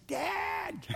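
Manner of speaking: high, long-held cry, held back in volume; mock holler for a father; calling back to Dad. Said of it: "And he said".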